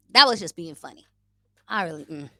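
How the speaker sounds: usual speaking voice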